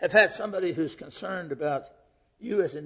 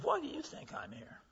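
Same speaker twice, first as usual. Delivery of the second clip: high, soft searching question; probing question; 10 semitones higher, 10 dB quieter than usual. Retold or imitated. Preacher quietly asking the inmate why he came, high and soft. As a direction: coax the answer out gently. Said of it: "turned to this inmate and said".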